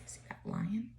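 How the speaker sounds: breathy hushed aside, no voice at all; whisper. Shown as a demonstration. Teacher whispers to her class during the read-aloud. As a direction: whisper it like a secret to the kids. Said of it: "aside to listening children".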